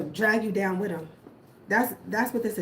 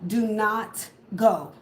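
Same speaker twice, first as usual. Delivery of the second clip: slow, weighted, each word hit; emphatic warning; much slower than usual. Real, not acted. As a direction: Do not go, spoken slowly as a firm command. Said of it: "And I mean that strongly".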